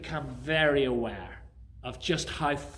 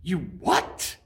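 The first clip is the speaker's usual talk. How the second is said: louder disbelieving you what; incredulous; 3 semitones higher, 5 dB louder than usual. Acted. Jack stunned by God's answer, incredulous. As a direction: react in shock.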